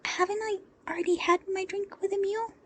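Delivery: put-on high small child's voice, a touch anxious; a kid unsure if she's allowed; high, timid child's question; worried little girl. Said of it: "she asked a little worriedly".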